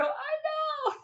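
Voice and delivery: high, tearful wail; mock crying. Wife answering husband who notices her tears, mock-crying wail. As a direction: wail it like a hammed-up sob. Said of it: "you're crying".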